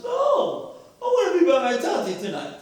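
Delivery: high-pitched wailing child imitation; mock tantrum, starting very high; crying little boy. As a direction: cry out like a child refusing. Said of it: "And he starts crying".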